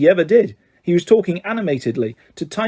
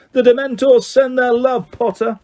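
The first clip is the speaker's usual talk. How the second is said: jeering schoolboy calling out high; a bully's mocking call; taunting shout; 8 semitones higher than usual. A narrator's reading. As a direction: sneer it across the crowd. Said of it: "who shouted through the crowd".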